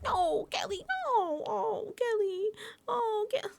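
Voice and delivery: imitating Vince pleading with Kelly; mock pleading. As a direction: ham up a whiny plea. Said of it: "he's doing a shtick".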